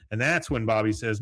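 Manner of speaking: ordinary talk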